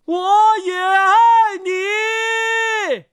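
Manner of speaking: high, extremely drawn-out answering call; roared reply. A narrator's reading. Surging sea answers the words, calling long. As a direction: send it back high, holding the notes long. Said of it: "吼道".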